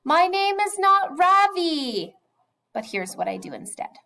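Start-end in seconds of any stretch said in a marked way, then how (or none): 0.0-2.1 loud, high, slow shout of protest; imagined shout. Pretend. Boy bursting out at classmates about his name, loud shout. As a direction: let it burst out at full voice. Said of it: "shout at the top of my voice".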